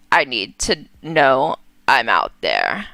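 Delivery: low, halting mother's voice; Vera, low and hesitant. Acted. Vera's ghost speaks to her daughter in a low halting voice. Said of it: "that's her voice".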